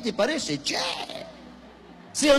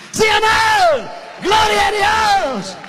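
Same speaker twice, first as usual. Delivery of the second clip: high, loud, rousing shout; full-force preaching; 12 semitones higher, 9 dB louder than usual. Real, not acted. Preacher glorifies God's supernatural strength to the congregation, full-force shouting. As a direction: thunder it out to rouse the congregation.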